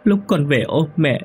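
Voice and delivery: put-on high, tearful child complaining; crying ghost child. Ghost child tells mother of cold, high and tearful. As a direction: whimper like a cold little child. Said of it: "khóc nức nở".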